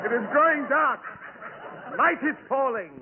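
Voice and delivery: milk the doom of nightfall; overacted melodrama villain; hammy drama. Hammy dramatic stage lines.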